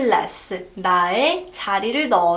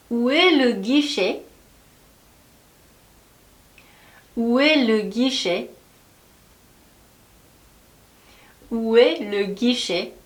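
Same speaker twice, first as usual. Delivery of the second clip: very slow, word by word; slow drill; much slower than usual. Shown as a demonstration. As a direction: model it slowly for repeating. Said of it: "한번 만들어 볼까요?". (따라 하도록 아주 천천히)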